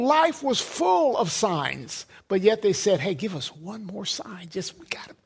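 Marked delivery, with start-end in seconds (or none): 3.0-5.1 hushed, near-whispered mock plea; mock wheedling. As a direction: wheedle under your breath, imitating doubters. Mimics doubters asking one more sign.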